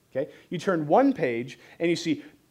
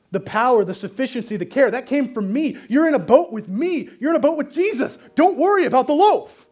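building to a high, loud plea; rising passion, almost pleading; impassioned Jesus; 8 semitones higher, 7 dB louder than usual. Acted. Jesus tells doubting disciples don't worry, rising loud plea.

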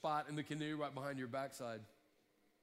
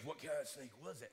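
Bubba voice; 5 semitones lower, 6 dB quieter than usual. Retold or imitated. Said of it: "this big old Bubba kind of guy".

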